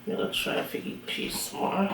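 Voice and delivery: whispered aside to herself. Real, not acted. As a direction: breathe it out under your breath. Aside while eating, stuffed.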